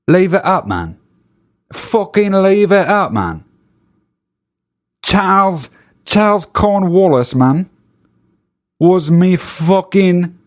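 high, hammed-up British accent defending his heritage; English guy accent. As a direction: ham up a cheeky English lad accent, voice pushed high. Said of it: "some English guy just being like".

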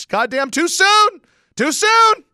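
high, loud frantic yell, slow; panicked shout. Retold or imitated. Shouting at the Saints celebrating too early, high and loud. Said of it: "and I said".

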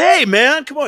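high loud hey man protest; protesting yell. Retold or imitated. To the TSA guy, a high loud protest. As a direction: yelp it out in complaint.

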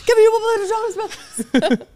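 high imitation through laughter; laughing imitation. Cohost mimics her boyfriend's shout, laughing. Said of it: "and then it hangs up".

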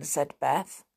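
ordinary delivery, nothing marked

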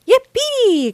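high, loud squeal of joy; soaring, excited whoop; gleeful cry. A narrator's reading. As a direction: cheer like a thrilled child. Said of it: "cried Peppa".

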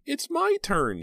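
put-on high-pitched little girl shouting; child shouting. A narrator's reading. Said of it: "she shouted".

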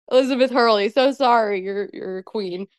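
ordinary talk, nothing marked

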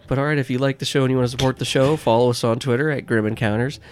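upbeat podcast outro read; announcer read. Acted. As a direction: read the plug like a host.

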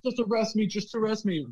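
high-pitched mock pleading demand; insistent arrestee, imitated. Bartender voicing a customer to security, high and insistent. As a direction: imitate a worked-up man daring the guards. Acted stand-off with security guards.

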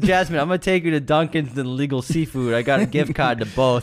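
high, loud Boston guy voice; Boston accent. Pretend. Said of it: "Boston Aladdin".

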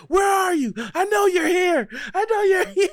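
loud, very high frantic shout; panicked yelling. Acted. Masked gunman searching the front of house, panicked yelling. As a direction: yell out desperately for someone hiding.

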